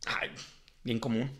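normal talking voice

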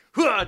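loud lunging "hua"; mock fight noise, high and forceful; imitated attack grunt. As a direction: mimic a man launching into a tackle. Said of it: "just be like".

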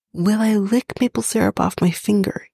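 ordinary talk

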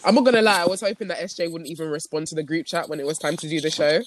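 ordinary talk, nothing marked

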